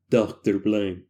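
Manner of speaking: low, put-on doctor voice; trying a doctor voice. Shown as a demonstration. Narrator trying out a voice for Doctor Blank, low put-on voice. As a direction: drop well down to test a character voice. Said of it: "I'm going to try to do different voices".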